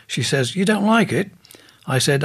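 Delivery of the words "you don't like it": high, taken-aback question; surprised question. Retold or imitated. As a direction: pitch it up in disbelief.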